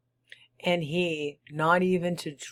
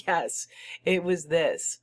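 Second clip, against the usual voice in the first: laughing; 3 semitones higher than usual. Acted. Narrator laughs at own idea.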